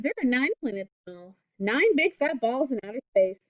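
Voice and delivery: normal talking voice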